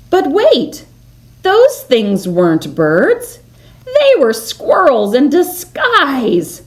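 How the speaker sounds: usual speaking voice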